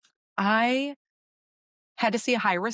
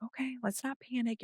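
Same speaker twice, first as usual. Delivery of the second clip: soft, much quieter soothing voice; calm reassurance; 4 semitones higher, 10 dB quieter than usual. Retold or imitated. Doctor calming her frightened pregnant patient, soft and reassuring. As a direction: settle a worried patient gently.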